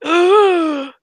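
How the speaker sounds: loud, high gasp of shock; exaggerated freaked-out gasp; retold gasp. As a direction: act out a big horrified intake.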